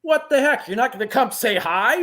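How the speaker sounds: high, loud mock indignation; Kane Hodder griping. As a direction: act the offended curmudgeon, breaking into laughter. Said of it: "he's like a curmudgeon at times".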